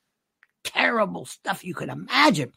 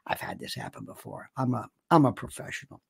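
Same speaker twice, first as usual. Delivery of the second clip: hushed, whispered aside from a seasoned pro; imagined whisper; 6 semitones lower, 10 dB quieter than usual. Retold or imitated. Baldwin imagined whispering he's a professional, mostly hushed. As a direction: whisper it like it's old news to him. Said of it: "where he would say".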